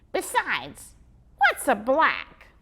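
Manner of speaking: puzzled little squirrel; squeaky squirrel voice. Acted. Squirrel puzzled by the boy's word, squeaky.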